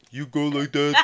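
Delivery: ordinary talk, nothing marked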